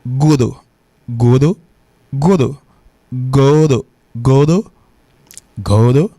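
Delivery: godo as "GOH-doh", repeated for learners; godo, each one set apart; second pronunciation demo. Shown as a demonstration. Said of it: "et il prononce".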